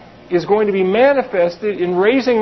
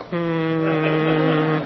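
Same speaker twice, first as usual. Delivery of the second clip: low, lazy hum of a New Ager; mock meditative hum; 7 semitones lower, 4 dB quieter than usual. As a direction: hum it like someone lost in meditation. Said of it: "They're like".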